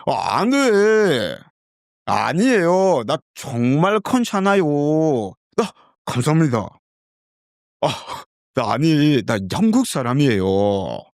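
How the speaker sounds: high, fast, dramatic Korean; over-the-top Korean. Shown as a demonstration. Learner overacting Korean to practise intonation, high and fast. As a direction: act it out like an over-the-top actor. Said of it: "putting myself so much in, so much emotion".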